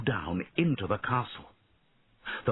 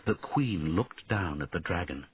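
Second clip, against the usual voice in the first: ordinary delivery, nothing marked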